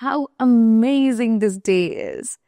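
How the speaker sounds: very slow, leaning on amazing; slow exclamation demo. Shown as a demonstration. Teacher showing a how-exclamation to students, very slow. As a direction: stretch amazing out.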